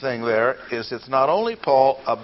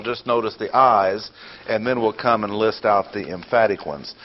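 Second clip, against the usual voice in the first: normal talking voice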